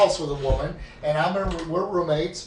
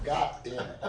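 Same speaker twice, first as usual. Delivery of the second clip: normal talking voice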